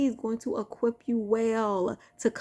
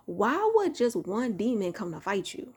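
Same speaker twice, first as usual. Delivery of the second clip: ordinary talk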